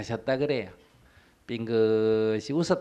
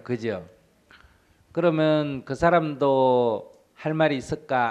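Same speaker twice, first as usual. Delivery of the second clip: ordinary talk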